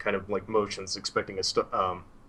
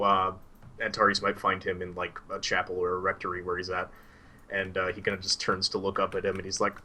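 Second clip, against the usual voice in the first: ordinary talk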